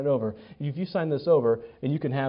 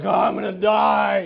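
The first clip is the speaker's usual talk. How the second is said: slow, higher mock collapse after mowing; exhausted drama; 5 semitones higher, 5 dB louder, slower than usual. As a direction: groan it like you're dying of fatigue. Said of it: "you're like".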